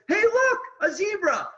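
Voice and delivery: spring the final joke with a bright shout; excited discovery. Acted. Reading the back cover's last line to the audience, excited. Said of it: "it says".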